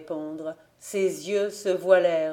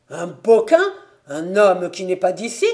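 loud, indignant questioning; 8 dB louder than usual. Acted. Son objecting to the outsider his sister will marry, indignant. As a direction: throw it back in loud disbelief.

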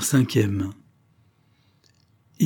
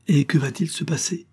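with terror; 7 semitones higher than usual. Acted. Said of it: "avec une sorte de terreur".

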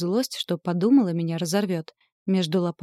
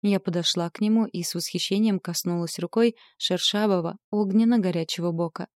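ordinary delivery, nothing marked

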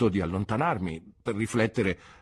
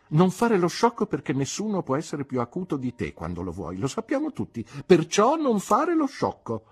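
high, loud reproach, slowing at the end; scolding; 8 semitones higher, 6 dB louder than usual. Acted. Aunt telling Mister Dick not to act the fool, scolding. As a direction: scold him like a stern old aunt.

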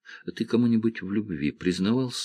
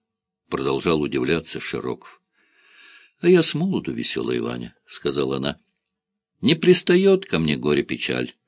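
ordinary delivery

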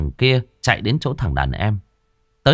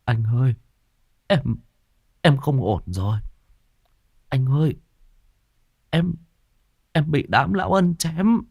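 faint dying gasp; 8 semitones higher than usual. Acted. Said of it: "miệng thều thào".

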